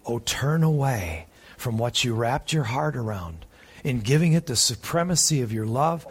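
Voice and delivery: normal talking voice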